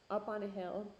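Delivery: ordinary talk, nothing marked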